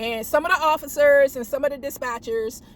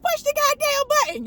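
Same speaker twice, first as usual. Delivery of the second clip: imitating a coworker; 13 semitones higher than usual. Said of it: "Like that little Chinese girl".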